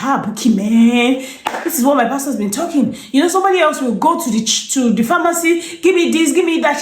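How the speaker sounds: high, lively gushing; excited relieved friend. Retold or imitated. Friend calling back to say the remedy worked, excited. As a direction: gush with relief and amazement.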